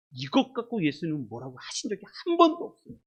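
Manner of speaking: usual speaking voice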